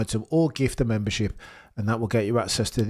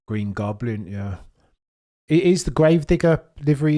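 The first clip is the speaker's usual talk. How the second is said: ordinary talk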